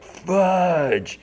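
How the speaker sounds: high, drawn-out curse that falls at the end; comic cry of dismay; exasperated outburst. Acted. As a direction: wail it in mock frustration. Boss realises he was overheard.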